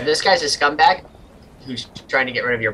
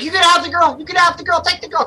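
high, loud panicked pleading; frantic offer, pitched right up; panicked captain; 4 dB louder, faster than usual. Acted. Ship's captain begging attackers to take the girl, panicked.

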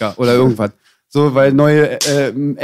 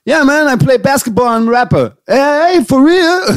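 excited rapper; 9 semitones higher than usual. Retold or imitated. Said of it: "Und er so".

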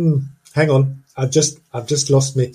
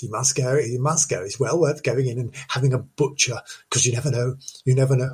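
usual speaking voice

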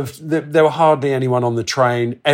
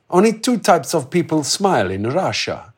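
put-on light Russian accent; 4 semitones higher than usual. Quoting Val, a Russian man.

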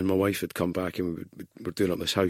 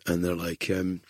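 ordinary talk

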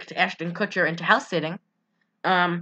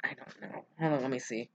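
hushed, breathy aside to herself; whispered aside; 6 semitones lower, 14 dB quieter, faster than usual. Real, not acted. Friend mutters to herself checking her notes, whispering.

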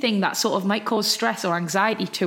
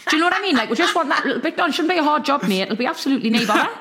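fast, higher, breathless chatter to the builder; rushed chatty pleading; 6 semitones higher, faster than usual. Retold or imitated. To the builder about the beam, rushed cheery Geordie patter. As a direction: rattle it off a million miles an hour, no pause. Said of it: "I'm like".

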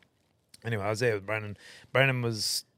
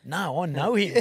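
raised, louder protest; acted insistence; 6 semitones higher, 5 dB louder, slower than usual. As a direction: insist to a doubting mate, higher and louder. Insists against friend's disbelief, acted.

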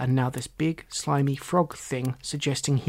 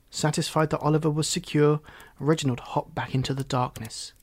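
ordinary delivery, nothing marked

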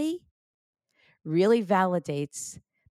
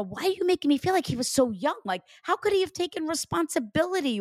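high, hurried questioning of a spirit; retold protest; 9 semitones higher, faster than usual. Medium retelling how she challenged the father's spirit, protesting. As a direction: imitate puzzled pushback, pitched up and quick.

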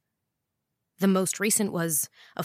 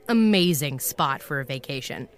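ordinary talk, nothing marked

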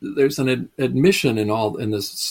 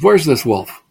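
louder, higher, demanding question; bold call for the wolf; 4 semitones higher, 8 dB louder than usual. Retold or imitated. Francis arrives in town, loudly asking for the wolf. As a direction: call out boldly, looking for the wolf. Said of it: "he says".

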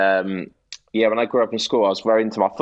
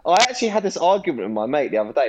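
ordinary talk

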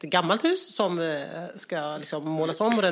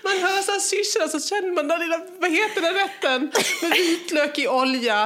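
much higher, breathless giggling; higher, giggling through a Spanish-evening daydream; laughing fantasy; 10 semitones higher, faster than usual. Real, not acted. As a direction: dream aloud while laughing. Daydreaming about Spanish evenings, cracking up.

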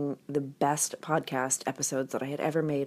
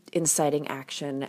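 ordinary talk

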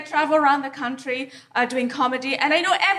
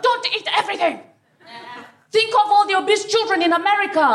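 mock shout; 5 semitones higher, faster than usual.

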